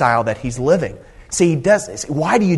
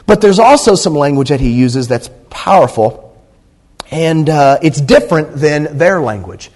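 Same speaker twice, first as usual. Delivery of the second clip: louder preaching that climbs; full-force preaching; 2 semitones higher, 6 dB louder than usual. Acted. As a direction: drive the message home to the church. Preacher building on Jesus' words.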